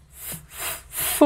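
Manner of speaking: repeated voiceless "F" puffs, then a long "fo"; som do F. Shown as a demonstration. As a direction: isolate "F" before drawing out "fo". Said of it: "Olha o som". ("F" soprado, depois "fo" bem alongado)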